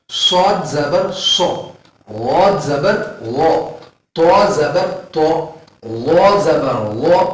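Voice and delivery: each letter with zabar said very slowly, syllable by syllable; slow syllable drill. Shown as a demonstration. Teacher drilling zabar syllables slowly for students. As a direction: drill the zabar sounds slowly like a class chant. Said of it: "زبر اور زیر اور پیش کی حرکتیں".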